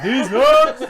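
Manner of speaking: loud, high yell; shouted punchline. Pretend. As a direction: belt out the punchline.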